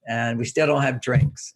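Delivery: ordinary delivery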